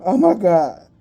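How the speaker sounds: ordinary talk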